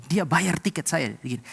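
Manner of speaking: ordinary delivery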